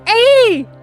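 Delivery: high, loud, thrilled exclamation; delighted shout. Retold or imitated. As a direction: yelp with happy recognition. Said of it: "I was like".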